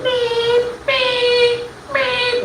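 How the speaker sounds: high sung beeps like a speaker box; beep, beep, beep, sung high and thin; imitated drive-thru beep. Comic imitating the drive-thru order box beeping.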